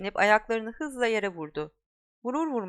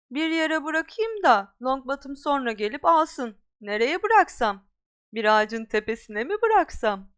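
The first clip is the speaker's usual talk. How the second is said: louder, higher mocking taunt; Malfoy taunting Harry with the ball; teasing bully; 4 semitones higher, 5 dB louder than usual. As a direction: dangle it in front of him.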